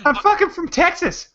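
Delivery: brash put-on Texan bragging; Texas accent. Mocking a Texan in a hammed-up Texas accent. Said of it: "that's a great Texas accent".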